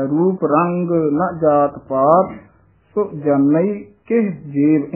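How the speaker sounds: ਜਾਣਈ said slowly with the ਈ sound; slow reading that sounds ਜਾਣਈ; careful recitation. Shown as a demonstration. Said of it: "ਸੋ ਜਾਣਈ ਈੜੀ ਦੇ ਨਾਲ ਪਾਠ ਹੈ".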